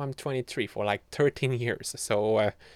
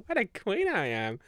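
higher, giggling repeat; laughing; 7 semitones higher than usual. Pretend. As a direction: laugh through the brag.

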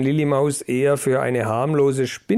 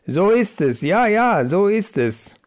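raised, eager cry of agreement; chorus of mice agreeing, a touch louder; crowd crying agreement; 7 semitones higher, 3 dB louder than usual. A narrator's reading. Mice answer their boss, eager cry of agreement. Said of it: "Wieder schrie der schlaue Mäuse Josef".

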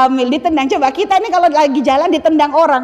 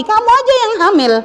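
accusing; 9 semitones higher than usual. Retold or imitated. Wife pushing pregnancy onto her husband, mock accusing. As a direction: point the finger at him. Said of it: "tuding-tudingan sama suami".

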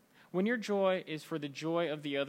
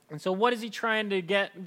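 ordinary talk, nothing marked

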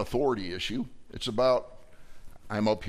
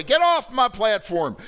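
commanding shout; 9 semitones higher, 7 dB louder than usual. Acted. Domineering voice again.

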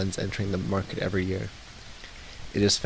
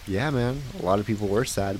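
ordinary talk, nothing marked

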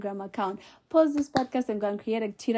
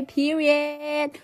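ordinary delivery

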